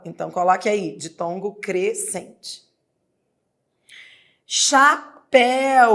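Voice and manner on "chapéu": Chapéu said slowly and higher, each syllable drawn out; teacher modelling Chapéu slowly; slow demo pronunciation. Shown as a demonstration. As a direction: sound out Chapéu for the class, slow and clear. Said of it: "O que que você tem aí de encontro vocálico".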